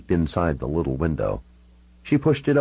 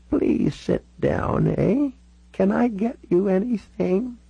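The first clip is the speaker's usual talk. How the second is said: put-on slow elderly hostess, rising high; old Canadian lady offering hospitality, unhurried; old Canadian woman; slower than usual. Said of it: "She certainly sounded like a lifelong Canadian".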